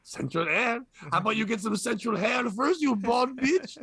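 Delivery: sassy mock-French comeback; French accent. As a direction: hit back in a put-on French accent. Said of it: "answer in a French accent".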